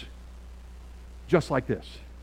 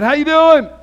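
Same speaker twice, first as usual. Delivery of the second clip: much higher and louder bright hello; cheery greeting; 13 semitones higher, 16 dB louder than usual. Retold or imitated. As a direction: call out a big friendly hello. Greets young man at Thornton's.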